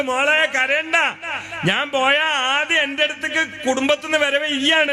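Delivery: high-pitched preacher retelling a father's comfort; retold cheering-up. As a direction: console a daughter in a high, carrying voice. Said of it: "വാപ്പ സന്തോഷിപ്പിച്ചിട്ട് പറയാണ്". (മകളെ സന്തോഷിപ്പിക്കുന്ന ഉയർന്ന സ്വരം)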